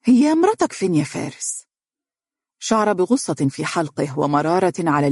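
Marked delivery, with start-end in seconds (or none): 0.0-1.7 probing question, pitched high at the start; suspicious. A narrator's reading. Mother asks her son where his wife is, suspicious. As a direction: raise the voice's pitch in wary doubt. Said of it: "وهي تقول بارتياب".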